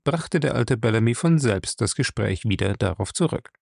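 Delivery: normal talking voice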